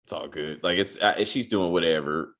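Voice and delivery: a low, laid-back murmur for Bobby; low, soft mock Bobby. Recapping the show, he voices Bobby low and unbothered. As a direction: play it cool and low, brushing it off. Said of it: "And Bobby was like".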